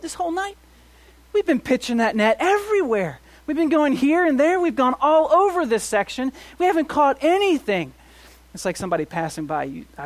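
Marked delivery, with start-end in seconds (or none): 0.0-7.9 loud, high, fed-up protest; exasperated fishermen. Retold or imitated. Disciples protesting to Jesus after an empty night, loud and exasperated. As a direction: vent like tired men who caught nothing.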